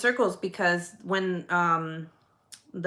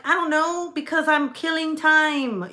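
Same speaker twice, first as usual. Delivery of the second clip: whiny excuse; 8 semitones higher, 4 dB louder than usual. Retold or imitated. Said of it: "I'm like".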